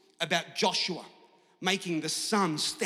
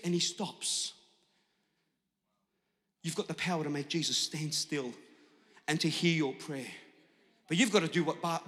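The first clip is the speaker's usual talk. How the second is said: usual speaking voice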